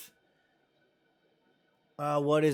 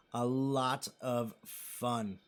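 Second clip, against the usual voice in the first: very slow, soft and lower; slow emphatic repeat; 4 semitones lower, 6 dB quieter, much slower than usual. Real, not acted. Streamer savoring memories of the trip, slow and emphatic. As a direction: draw out each word of "A lot of fun", softly.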